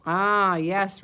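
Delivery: normal talking voice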